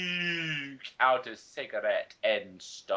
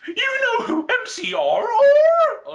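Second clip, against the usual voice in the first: far higher and louder fake-shocked squeal; mock gasp; 11 dB louder than usual. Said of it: "I gasped".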